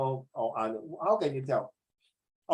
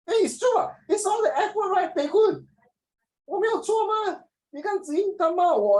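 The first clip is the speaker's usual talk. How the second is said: very high-pitched, louder mock indignation; joking high-voiced plea of innocence; mock protest; 5 dB louder than usual. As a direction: squeal in playful self-defence.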